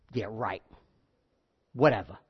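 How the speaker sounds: low, quiet, dismissive brush-off; dismissive scoff. Retold or imitated. Brothers mocking Joseph's dream, a low quiet scoff. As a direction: scoff it away like a doubting brother. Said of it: "the attitude like".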